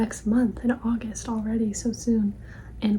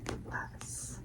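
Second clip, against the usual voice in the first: faint whispered echo; 11 semitones lower, 15 dB quieter, much slower than usual. Acted. Title repeated faintly.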